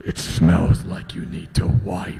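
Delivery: low, slow menacing taunt; creepy killer. Acted. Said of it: "I'll be the bad guy or the serial killer".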